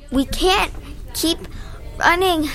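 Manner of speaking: out of breath; panting. A narrator's reading. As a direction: gasp it out winded. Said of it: "Nick panted".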